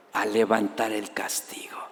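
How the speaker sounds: whispering God's tenderness from the verse; whispered scripture. Real, not acted. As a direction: breathe the verse out as a secret.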